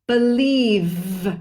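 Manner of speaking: believe with a long buzzing V; exaggerated V. Shown as a demonstration. Teacher exaggerates the buzzing V ending. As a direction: drag out the final V of believe. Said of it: "I'm exaggerating just to show the difference".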